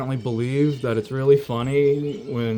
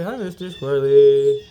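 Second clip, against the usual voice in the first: louder, bright hello to a pet; cheerful greeting; 7 dB louder than usual. Real, not acted. Greeting the squirrel, a loud happy hi. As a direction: call out happily to the squirrel.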